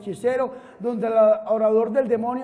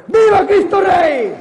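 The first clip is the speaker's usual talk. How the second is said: shouted, louder and higher, slow; rallying cry; 5 semitones higher, 8 dB louder, slower than usual. Real, not acted. Preacher leading the congregation's cheer, shouted loud and high. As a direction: lead the crowd in a cheer.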